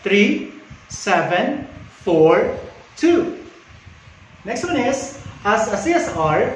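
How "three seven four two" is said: "Three seven four two" slow, each digit on its own; paused phone number. Shown as a demonstration. Operator giving a number to write down, "Three seven four two" slow and spaced.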